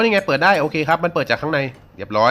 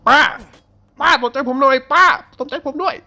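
mock pleading; 11 semitones higher than usual. Teasing the knife-wielding old woman.

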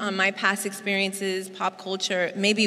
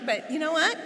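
mock wife; 4 semitones higher than usual. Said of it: "the wife is like".

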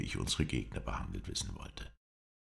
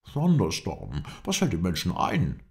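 loud, high-pitched exclamation; outraged cry; 4 semitones higher, 5 dB louder than usual. A narrator's reading. Englishman reacts to the captive's release, crying out loudly. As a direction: cry out in indignant disbelief. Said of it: "schrie der Engländer".

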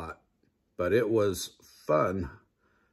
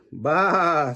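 higher bleating baa; 6 semitones higher, 4 dB louder than usual. A narrator's reading. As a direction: bleat like a sheep. Sheep noise in story.

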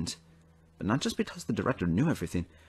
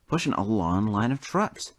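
usual speaking voice

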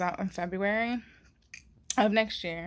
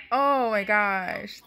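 moved disbelief; 7 dB louder, slower than usual. Real, not acted. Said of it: "I'm emotional".

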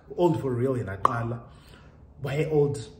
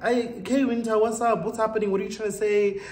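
higher, louder puzzled questions; incredulous; 9 semitones higher, 4 dB louder than usual. Retold or imitated. Retelling confusion at friend's hint, high baffled questioning. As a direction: press her, baffled. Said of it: "I was like".

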